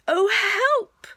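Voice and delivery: high, loud cry for help; alarmed cry. Acted. Pooh stuck in the hole, alarmed.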